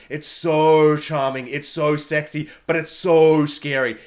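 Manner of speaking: drawn out, low and slow; slow and savouring. Real, not acted. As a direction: linger on each so. Savouring the actor's charm.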